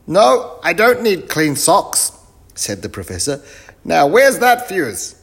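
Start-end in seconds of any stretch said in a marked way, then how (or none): 0.1-2.1 loud, very high professor voice; muddled professor. Acted. Professor mishears the greeting, answers loud and high. 3.8-5.1 loud, very high professor voice; muddled professor. Acted. Professor mishears the greeting, answers loud and high.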